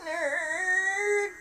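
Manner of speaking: mock crying. Mock sobbing continues.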